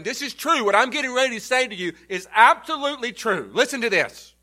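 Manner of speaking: urgent emphatic voicing of Jesus. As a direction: insist it is absolutely true.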